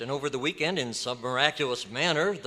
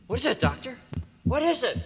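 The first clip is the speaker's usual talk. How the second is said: rising high alarmed pleading; frightened questions; 9 semitones higher than usual. Acted. Reporter begs the doctor to explain, frightened. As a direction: press him in mounting fear.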